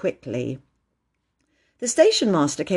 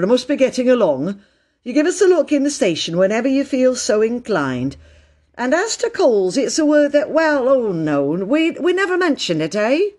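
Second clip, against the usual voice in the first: higher-pitched, jovial station master; friendly station master; 5 semitones higher, 5 dB louder than usual. A narrator's reading. Station master making peace with Peter, friendly. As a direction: play a station master seeing the boy off.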